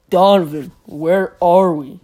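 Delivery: loud, slow mocking of her voice; girl's voice impression. Kid imitating a classmate's voice, loud and slow. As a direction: mimic the girl calling his name. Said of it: "Her voice is more high-pitched than a mouse".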